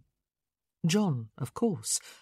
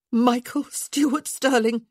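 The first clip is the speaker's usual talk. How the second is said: scolding; 7 semitones higher, 7 dB louder than usual. Acted. Scolding full name.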